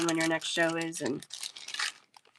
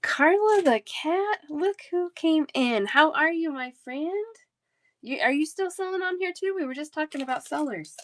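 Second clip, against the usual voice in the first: excited greeting; 8 semitones higher than usual. Real, not acted. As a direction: squeal a happy welcome as a friend walks in. Delighted greeting of returning viewer.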